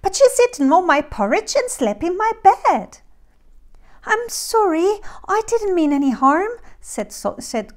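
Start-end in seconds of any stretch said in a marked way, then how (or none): 0.0-3.0 high, loud cub complaint; squeaky little bear. A narrator's reading. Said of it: "squeaked the very small bear". 4.1-6.5 put-on high, pleading little girl voice; apologetic Goldilocks. A narrator's reading.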